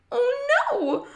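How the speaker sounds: very high, loud shriek of dismay; high-pitched wail of alarm. A narrator's reading. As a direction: shriek it in horror. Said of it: "shrieked Joanne".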